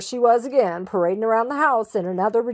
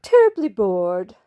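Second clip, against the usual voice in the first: terribly bored; 7 dB louder than usual. A narrator's reading. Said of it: "fine-tuning her favorite expressions".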